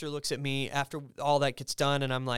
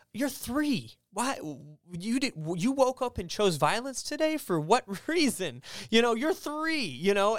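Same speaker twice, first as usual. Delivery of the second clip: amused disbelief; 7 semitones higher than usual. Real, not acted. Mock outrage at three-year-old.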